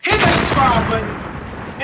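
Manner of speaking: slow drawn-out hype line; slow hype call. Real, not acted. Slow hyped outro call.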